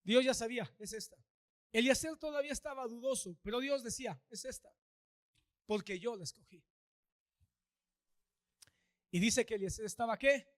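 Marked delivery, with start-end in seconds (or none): 0.8-1.2 soft divine voice. Acted. God's voice choosing Rebeca. 4.3-6.6 soft divine voice. Acted. God's voice choosing Rebeca.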